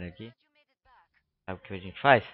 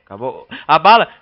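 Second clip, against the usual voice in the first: loud alarmed cry; alarmed shout; 5 semitones higher, 19 dB louder than usual. Real, not acted. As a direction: yell it in alarm. Runs out of ammo in game. (grito alarmado)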